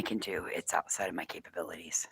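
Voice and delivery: under-the-breath whisper to self. Real, not acted. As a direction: mutter it barely voiced. Muttered aside to self.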